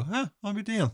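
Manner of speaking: high-pitched, wondering "huh"; retold surprise. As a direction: discover it with a high, light surprise. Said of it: "and go".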